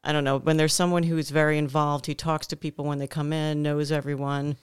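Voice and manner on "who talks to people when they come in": ordinary talk, nothing marked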